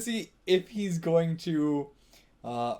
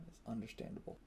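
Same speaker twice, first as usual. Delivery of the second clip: whispered aside; 2 semitones lower, 16 dB quieter than usual. Real, not acted. Repeats line, then whispers it.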